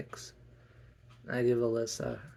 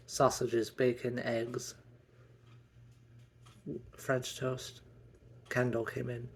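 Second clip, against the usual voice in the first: ordinary talk, nothing marked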